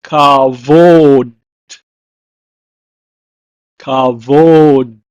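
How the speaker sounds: kavod said slowly "kah-vohd", stress at the end; "Kavod" repeated, loud and slow; Hebrew word. Shown as a demonstration. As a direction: draw out "kavod" with a falling end. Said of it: "It's pronounced in Hebrew as kavod".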